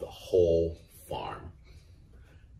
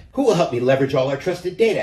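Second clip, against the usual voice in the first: higher, fast hen voice; eager hen; 7 semitones higher, 6 dB louder, faster than usual. A narrator's reading. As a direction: rattle it off eagerly. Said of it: "asked the little red hen".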